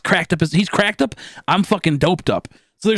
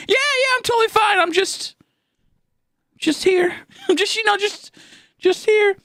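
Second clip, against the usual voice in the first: much higher, nervous fake-casual voice; faking fine. Hiding panic from the nurse, squeaky fake-calm voice. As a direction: squeak it out like nothing's wrong.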